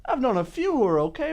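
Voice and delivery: ordinary talk, nothing marked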